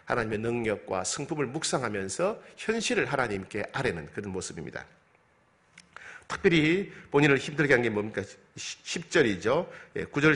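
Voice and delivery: ordinary delivery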